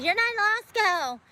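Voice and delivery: high, mocking voice of a know-it-all; mocking critic. Answering a viewer who doubts her law studies, high mocking sneer. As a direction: throw the critic's jab back in a high sneer. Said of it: "Before somebody said".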